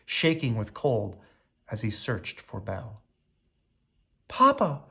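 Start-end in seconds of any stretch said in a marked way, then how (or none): 4.3-4.8 high anguished cry of Papa. A narrator's reading. Belle seeing her sick father in the mirror, crying out. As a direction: call out in alarm for her father. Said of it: "cried Belle".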